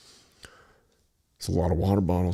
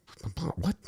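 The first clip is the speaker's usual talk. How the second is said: higher, softer baffled echo; disbelief; 10 semitones higher, 6 dB quieter than usual. Retold or imitated. Echoing girlfriend's words at the register, baffled and higher. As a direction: repeat her words back in disbelief. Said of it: "I'm like".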